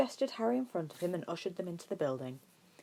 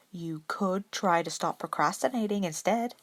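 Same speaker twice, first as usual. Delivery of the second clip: innocent voice; 3 semitones higher, 5 dB louder than usual. A narrator's reading. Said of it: "Ed offered in an innocent voice".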